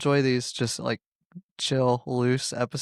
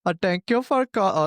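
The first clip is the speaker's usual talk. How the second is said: Indian telemarketer accent; 5 semitones higher than usual. Shown as a demonstration. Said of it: "I always say".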